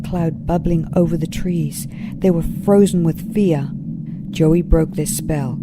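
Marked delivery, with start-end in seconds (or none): none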